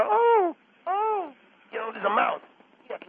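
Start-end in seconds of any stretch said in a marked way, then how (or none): 0.1-1.3 high squeal like a lady on a table; mock cartoon lady fright. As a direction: squeal at the mouse. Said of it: "cartoon with a lady ... she'll go".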